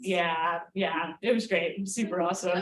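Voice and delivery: low, unimpressed sarcasm; deadpan sarcasm. Real, not acted. Comic to audience about costly tequila, deadpan sarcastic. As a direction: drop low and fake your approval.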